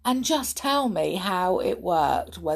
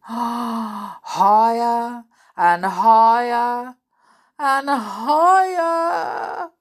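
very slow, stretched words stepping up in pitch; climbing excitement; 6 semitones higher, 8 dB louder, much slower than usual. A narrator's reading. Reader follows swings into the sky, climbing excitement. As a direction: draw out each word, rising like the swing.

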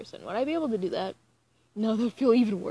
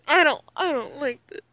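loud, higher-pitched complaint; whining protest; 6 semitones higher, 9 dB louder than usual. Real, not acted. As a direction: protest in a louder, higher whine. Protests having to keep reading.

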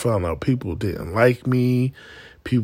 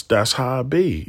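normal talking voice

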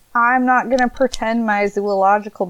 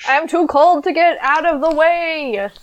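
higher, whiny iguana voice; 7 semitones higher than usual. Pretend. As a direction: play a cold helpless iguana. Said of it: "my iguana impression".

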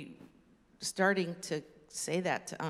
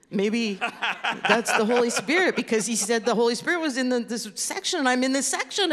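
loud, higher, hammed-up dawning realization; mock excited realization; 6 semitones higher, 11 dB louder than usual. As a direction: act out someone suddenly catching on, loudly. Acts out a listener's excited realization.

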